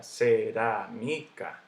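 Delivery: cerámica drawn out as ce-rá-mi-ca; syllable by syllable. Shown as a demonstration. Teacher breaking the word up for students, slow separate syllables. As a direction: slowly sound out each syllable of cerámica. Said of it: "Digo cerámica".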